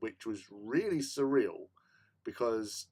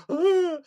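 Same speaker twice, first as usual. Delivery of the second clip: sudden high loud yelp of panic; a short panicked cry; 10 dB louder than usual. Retold or imitated. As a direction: let out a startled shriek. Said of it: "I panicked".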